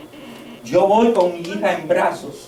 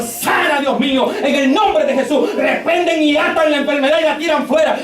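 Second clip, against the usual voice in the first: raised-pitch preacher's imitation of praying; fervent imitated prayer; 7 semitones higher than usual. Father imitating his daughters praying for their sisters, fervent preaching. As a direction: act out an urgent healing prayer.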